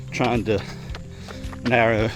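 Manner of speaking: normal talking voice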